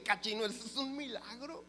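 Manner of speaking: high-pitched, wondering exclamation; amazed accountant. Retold or imitated. Accountant reacts to her client's news, amazed.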